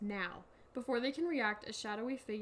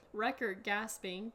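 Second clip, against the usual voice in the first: ordinary talk, nothing marked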